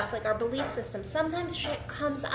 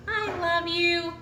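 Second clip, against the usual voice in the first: high, drawn-out pet-talk voice; slow, higher-pitched doting voice; cooing affection; 4 semitones higher, slower than usual. Real, not acted. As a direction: coo it slowly to an adored pet.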